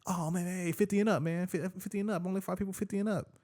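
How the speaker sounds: high-pitched mock challenger voice; retold older dude. Older dude challenging the two brothers, high imitated voice. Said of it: "he's just like".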